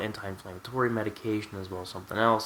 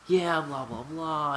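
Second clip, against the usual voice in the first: higher-pitched mock doctor voice; imitating the doctor; 7 semitones higher, slower than usual. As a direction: brush through it as a mimicked doctor. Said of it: "he comes through, and he's like".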